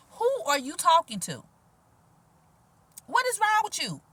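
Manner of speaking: high-pitched, incredulous telling-off; mock indignation. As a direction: confront an imagined rude caller, voice jumping up. Mock-confronting the rude callers.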